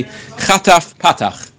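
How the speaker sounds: Chataf Patach said slowly, word by word; emphatic term. Shown as a demonstration. Teacher names the right vowel for students, slow and emphatic. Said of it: "how do you pronounce that word".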